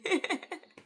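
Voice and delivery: high burst of real laughter. Real, not acted. Storyteller amused by the masquerade jibe, high real laugh. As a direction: laugh out loud, pitched well up.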